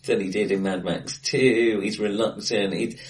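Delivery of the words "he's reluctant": ordinary delivery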